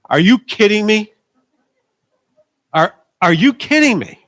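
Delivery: high, loud disbelief; incredulous outburst. Retold or imitated. Pastor telling the congregation his disbelief at the young man, loud and high. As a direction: throw your voice up in outraged amazement. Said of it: "I'm like".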